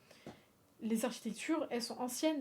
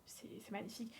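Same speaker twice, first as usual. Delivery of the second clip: whisper; 13 dB quieter than usual. Real, not acted. Whispered awe at a view.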